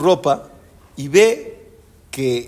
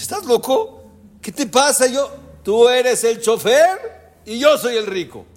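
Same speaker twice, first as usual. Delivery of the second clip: mock indignant; 7 semitones higher than usual. Quarrel over who is rich.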